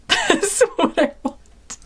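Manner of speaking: high, slow squeal of laughter; laughing. Real, not acted. Woman asking Santa for back rub, laughing. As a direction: crack up while saying it. Joking wish, breaks up laughing.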